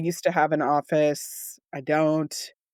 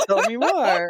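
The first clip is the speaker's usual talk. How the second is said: laughing; 4 semitones higher, 4 dB louder than usual. Real, not acted. Laughing while prompting sister.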